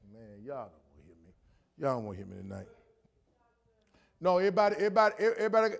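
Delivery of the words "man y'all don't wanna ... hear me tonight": much lower, much softer muttered aside; quiet teasing aside to the congregation. Real, not acted. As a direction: drop way down like talking to yourself.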